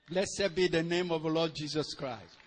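normal talking voice

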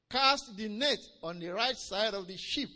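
proclaimed command. Retold or imitated. Preacher repeating Jesus's order to fishermen, proclaimed command. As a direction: declare the order to the congregation.

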